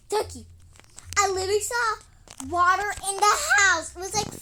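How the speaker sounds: loud alarmed calling; calling out urgently. Acted. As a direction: call the duck over in alarm.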